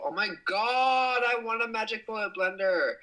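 long for it out loud; excited. Real, not acted. Streamer envying a viewer's new blender, high gushing.

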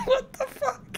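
high, slow, laughing through it; laughing disbelief. Real, not acted. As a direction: squeal it out mid-laugh.

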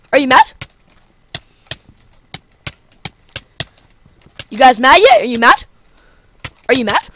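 high, loud mocking are you mad; goading taunt. Real, not acted. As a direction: needle the opponent until he snaps.

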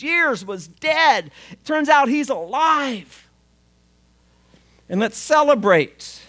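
a father overjoyed, throwing the party; joyful father. Acted. Father welcomes lost son home.

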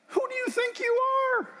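high, sneering mock voice of a skeptic; scoffing caller. Radio caller scoffing at the speaker's mission, high mocking voice. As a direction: squeak it out like a scornful caller.

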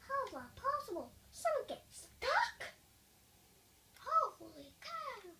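very high, incredulous outburst; astonished. Real, not acted. Player baffled at getting stuck in the game, astonished high voice. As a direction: pitch leaping up in disbelief.

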